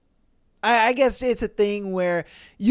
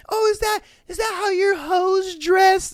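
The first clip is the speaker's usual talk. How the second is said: high, loud accusing shriek; mock angry wife; 12 semitones higher, 4 dB louder than usual. Wife accusing husband about his hoes, shrill shout. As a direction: accuse him with mock outrage. Wife getting mad, mimicked.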